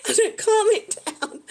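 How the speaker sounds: high-pitched, talking through giggles; real laughing. Real, not acted. Streamer laughing about unruly hair, laughing. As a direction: giggle helplessly through the words.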